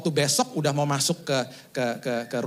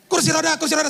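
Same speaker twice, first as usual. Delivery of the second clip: high, loud urgent calling out; hurried nurse call; 13 semitones higher, 5 dB louder than usual. Retold or imitated. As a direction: call after him in a rush. Nurse calling after rushing patient. (suster memanggil dengan tergesa-gesa, tinggi dan keras)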